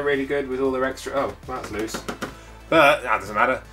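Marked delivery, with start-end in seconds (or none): none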